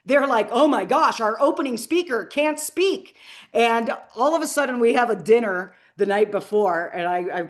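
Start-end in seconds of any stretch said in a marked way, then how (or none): 0.5-3.2 higher, louder imitation of panicked organizers; hammed-up dismay; mock freaking out. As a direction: act out their alarmed fretting. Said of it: "they're freaking out".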